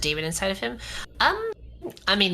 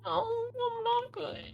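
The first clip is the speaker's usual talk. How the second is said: whiny high voice; 8 dB quieter, slower than usual. Pretend. Whiny fake distress.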